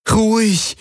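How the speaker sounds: a pleading "Ruhig" falling away. A narrator's reading. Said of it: "flehte Kirai ihn an".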